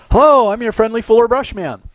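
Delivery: bright, high door-to-door pitch; chirpy Fuller Brush man at the door; cheery salesman greeting. Retold or imitated. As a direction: greet the customer like an eager salesman.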